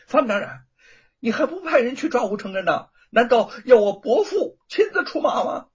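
loud, high, pressing threat; veiled threat. A narrator's reading. Son presses the hesitating prefect with a threat. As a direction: push him with a hard edge. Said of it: "软中带硬地威胁".

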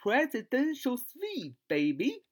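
high, drawn-out "wow" of delight; excited cheer. Acted. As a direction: cheer like a guest handed a luxury suite. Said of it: "所以你欢呼一声".

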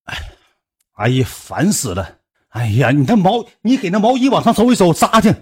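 loud, whiny annoyed groan; annoyed ugh. Retold or imitated. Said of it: "我给你学一下。".

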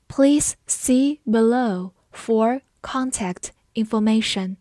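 slow, word-by-word with pauses; 慢速示范朗读. Shown as a demonstration. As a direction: read it slowly, one word at a time. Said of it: "我们慢读一遍". (一词一顿地慢读)

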